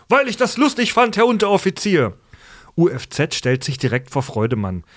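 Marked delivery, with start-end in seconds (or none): 0.1-2.1 high, overconfident recruit answer; cocky recruit. Retold or imitated. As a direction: answer a bit too cocky. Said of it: "etwas zu selbstbewusst".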